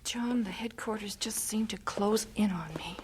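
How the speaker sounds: hushed, broken whisper; grieving whisper. Acted. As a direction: whisper it as if the walls are closing in. Grieving mother, sent child away.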